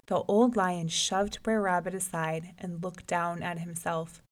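usual speaking voice

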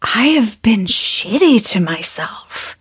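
hushed whisper of self-admission; whispering an inner confession. Retold or imitated. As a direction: confess it under your breath. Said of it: "the reckoning is where we say".

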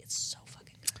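breathy whispered rave. Real, not acted. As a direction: whisper it like a secret. Savoring the burger.

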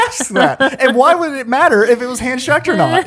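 amused disbelieving rant; incredulous. Real, not acted. Incredulous laughing comment.

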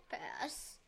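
whispered echo of the verdict; whispering. Real, not acted. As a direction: drop it to a whisper. Playful verdict said twice.

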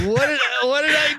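put-on high-pitched baffled kid; bewildered eight-year-old. Eight-year-old facing an angry girl, bewildered. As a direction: plead like a confused little boy. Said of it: "I'm an eight-year-old going".

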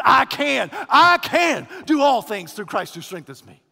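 "I can" hit loud and repeated, the rest falling away; stress on I CAN. Shown as a demonstration. Preacher showing a mistaken key phrase, heavy stress on "I can". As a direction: punch "I can", then let the verse drop. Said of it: "We think the most important phrase".

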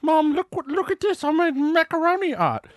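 put-on very high, eager little kid; excited child voice. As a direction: squeal it like a proud child. Child voice in retold scene.

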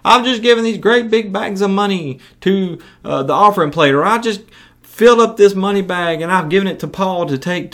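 high-pitched show-off voice; mock self-important boasting; boastful hypocrite. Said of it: "to call attention to their acts of charity".

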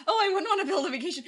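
high, fast, laughing goofy-hero voice; laughing through a silly high impression; mock Wesley. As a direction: play him as cheerfully clueless, giggling. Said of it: "He's like".

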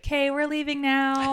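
put-on cheerily herd a drunk friend away; placating. Friend comes over to end the booth argument, placating.

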